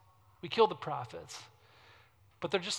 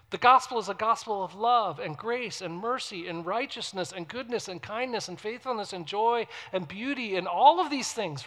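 ordinary delivery

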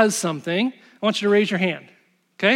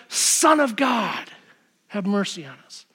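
pleading cry; 3 dB quieter than usual. Retold or imitated. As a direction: stretch it out like desperate blind men begging. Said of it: "and they cry out".

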